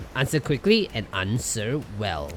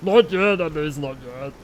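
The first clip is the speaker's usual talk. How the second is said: playful repeat; 9 semitones higher, 6 dB louder than usual. Acted. Repeating a line in playful voices.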